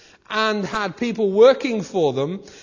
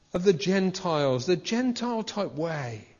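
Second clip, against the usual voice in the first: usual speaking voice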